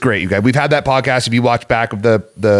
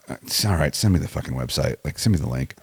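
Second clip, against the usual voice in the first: low, quiet retold grumble; imitating a reluctant, half-hearted yes; resigned reply; 9 semitones lower, 9 dB quieter than usual. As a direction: give in quietly, voice dropped low. Retelling his own grudging reply.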